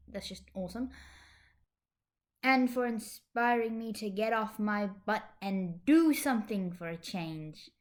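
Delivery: usual speaking voice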